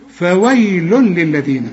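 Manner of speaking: فويل للذين slowly, ending "lul-lil" with no hum; merge without nasal. Shown as a demonstration. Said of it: "ما تغنش. شوف".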